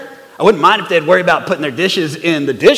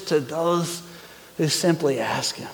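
soft reverent hush; 5 semitones lower, 10 dB quieter, slower than usual. Real, not acted. Soft close of scripture.

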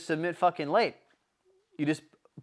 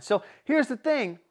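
usual speaking voice

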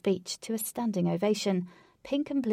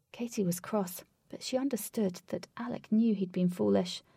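plain reading voice

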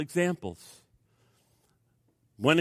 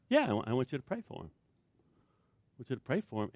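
calm insistence; 5 semitones lower, 9 dB quieter than usual. Acted. Spirit calmly insists.

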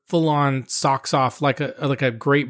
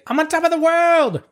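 Titanic Rose impression; 13 semitones higher than usual. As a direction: belt it out like Rose at the ship's bow. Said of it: "that was the character".

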